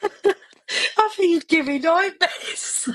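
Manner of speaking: high, drawn-out words through laughter; real giggling fit; helpless giggles. Real, not acted. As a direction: barely get it out for laughing. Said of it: "Oh, we've lost her now".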